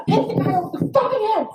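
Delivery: high, fast, breathless telling; high fast excited retelling; breathless excitement. Real, not acted. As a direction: rush through it, still keyed up. Retelling hitting the burglar.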